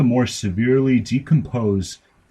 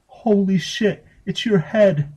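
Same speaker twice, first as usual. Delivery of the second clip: put-on girl's shocked exclaim; 12 semitones higher than usual. A narrator's reading. Girl finding her brother's head in the pizza box, high shocked cry. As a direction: shriek it in a high child's voice. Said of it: "the girl exclaimed".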